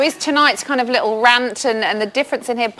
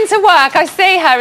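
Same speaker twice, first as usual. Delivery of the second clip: ordinary talk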